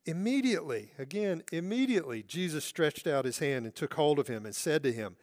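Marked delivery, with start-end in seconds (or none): none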